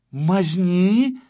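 loud, high, indignant question; outraged echo. A narrator's reading. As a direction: throw the word back in outrage. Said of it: "переспросил Тюбик". (возмущённо переспрашивая)

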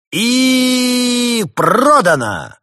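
high, loud, very slow final shout; booming prize announcer. Acted. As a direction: bang the gavel with your voice. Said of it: "объявил ведущий".

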